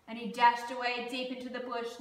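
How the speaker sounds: ordinary delivery, nothing marked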